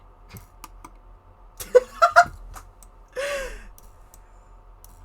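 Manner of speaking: high breathy giggle; high laugh. Real, not acted. Laughs at result.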